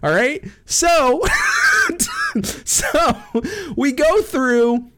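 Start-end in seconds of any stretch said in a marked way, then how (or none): 1.3-2.4 high shrieking burst of laughter. Real, not acted.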